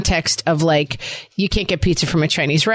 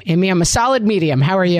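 usual speaking voice

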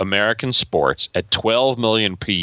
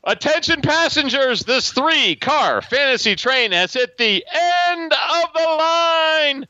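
high train conductor announcement; over the intercom. Pretend. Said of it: "let's hear that beautiful whistle".

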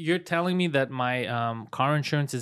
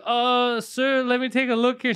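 flustered agent; 8 semitones higher, 4 dB louder, slower than usual. Retold or imitated. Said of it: "And she's like".

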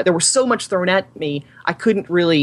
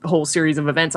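ordinary talk, nothing marked

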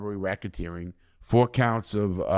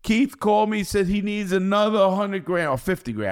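wife's voice; 10 semitones higher, 4 dB louder than usual. Retold or imitated. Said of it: "She goes".

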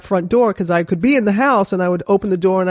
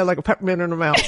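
ordinary talk, nothing marked

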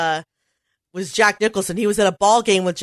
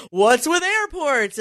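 Seinfeld impression; 7 semitones higher, slower than usual. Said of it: "[name]'s impression".

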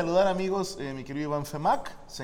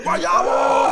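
loud whispered shout, much higher; imitated yell; 10 dB louder than usual. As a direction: hurl the name out in a raspy scream. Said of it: "estoy en mi casa y estoy".

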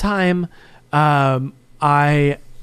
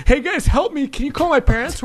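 high, pleading friend's voice with only a hint of tears; mock crying plea; 9 semitones higher than usual. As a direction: beg your buddies for help, pitched up, lightly whiny. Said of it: "he's crying".

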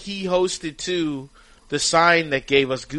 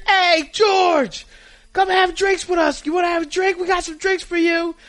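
calling out from a balcony; 13 semitones higher than usual. Retold or imitated. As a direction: holler up the hill to get his attention. Fans calling down from condo.

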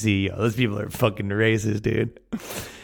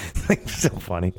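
breathless laugh; 3 semitones lower than usual. Real, not acted.

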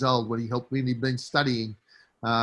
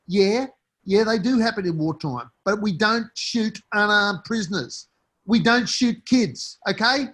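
indignant rebuttal; 6 semitones higher than usual. Real, not acted. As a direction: slam the excuse down, outraged. Rebutting those who defend Morant.

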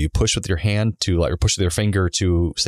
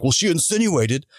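higher, slow, sneering imitation of his excuse; mocking the driver; 8 semitones higher than usual. Speaker mocking the tow driver's excuse, slow higher sneer. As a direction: mimic him as a whiny excuse-maker.

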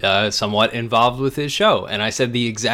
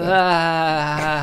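vocal fry endings; 5 semitones higher than usual. Shown as a demonstration. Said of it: "[name], can you do that sound again?".

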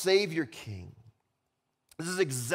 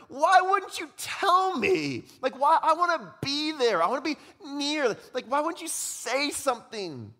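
pleading cry; 11 semitones higher, 4 dB louder than usual. Retold or imitated. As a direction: plead with hurt, much higher and louder. Pastor's retold plea to congregant.

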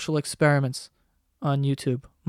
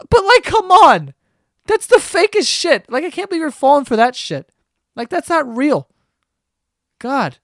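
much higher and louder, worked-up outburst; exasperated rant; 8 semitones higher, 5 dB louder than usual. Real, not acted. Ranting at viewers who fall for fake videos, loud and high. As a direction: explode at how fake it is.

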